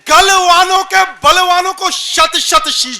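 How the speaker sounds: high, ringing heroic declamation; rousing poetry recitation. Acted. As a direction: proclaim the verse loudly to a crowd. Recites patriotic verse on stage. (जोशीला, बुलंद वीर रस काव्य पाठ)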